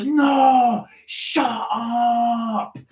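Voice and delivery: high, drawn-out mock shout; loud schoolkids. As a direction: holler it like a rowdy kid on the bus. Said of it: "they talk so loud on the bus".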